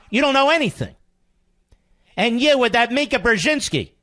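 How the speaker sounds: heated, high-pitched tirade; furious shouting rant; angry. Real, not acted.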